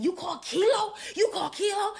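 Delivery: imitating an outraged yell; mock screaming. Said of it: "friend is screaming in the background".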